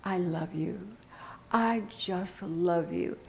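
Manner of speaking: soft, slow and tender, as if to yourself; tender self-affirmation. Shown as a demonstration. Minister showing the mirror affirmation to the congregation, soft and slow. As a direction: say it gently into the mirror. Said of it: "you tell yourself".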